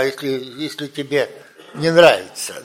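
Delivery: usual speaking voice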